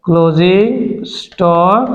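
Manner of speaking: slow, low, stretched-out words; writing-along dictation. Real, not acted. Teacher entering closing stock for the class, slowly sounding it out. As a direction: draw each word out as you write. Writing the entry while saying it.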